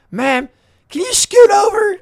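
loud, high call across the seats; mock shouted request. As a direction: holler at her to move over. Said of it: "the guy yesterday was like".

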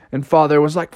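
usual speaking voice

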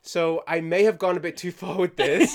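loud, high laughing retreat; laughing panic. Retold or imitated.

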